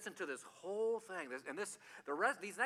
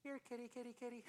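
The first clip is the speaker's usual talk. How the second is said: calling a cat; 8 semitones higher, 12 dB quieter, faster than usual. Retold or imitated. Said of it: "he just goes".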